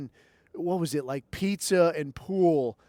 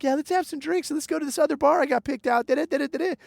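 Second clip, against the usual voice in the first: much higher, fast, bubbly mock girl voice; imitating her; 10 semitones higher, faster than usual. The girl planning their night out, high mock chatter. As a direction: rattle it off high and eager. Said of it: "she's like".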